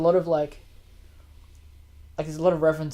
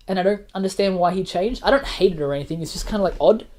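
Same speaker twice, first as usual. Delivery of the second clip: ordinary delivery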